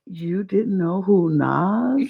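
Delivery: slow, stunned disbelief; incredulous. Retold or imitated. Retelling shock at sister not knowing [name]. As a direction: stretch it out, unable to believe it. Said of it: "And I was like".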